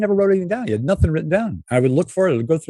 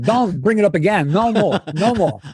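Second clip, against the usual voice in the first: imitating an irritated Walken; 9 semitones higher than usual. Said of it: "finally he said".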